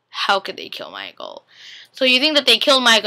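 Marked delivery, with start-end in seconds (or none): 0.1-1.4 very low, slow, hammed-up gasp of disbelief; mock dramatic disbelief. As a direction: drop the voice way down and drag it out in mock shock. And then you're just like.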